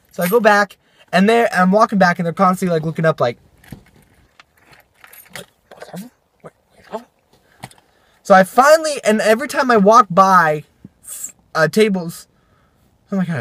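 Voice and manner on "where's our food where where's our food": very soft, higher mock query; barely audible imitation of impatient diners; hushed mimicry. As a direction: murmur it like nosy waiting customers. Mimics customers looking up impatiently.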